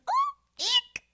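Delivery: squeaky high whispered eek; a tiny horrified squeak; mock cringe. Podcaster imitating her reaction to his acting, squeaky whisper. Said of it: "I'm like".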